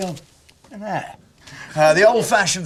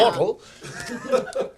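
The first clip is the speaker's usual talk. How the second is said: mocking supermodel; 5 semitones higher, 5 dB quieter, much slower than usual.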